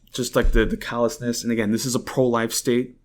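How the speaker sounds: normal talking voice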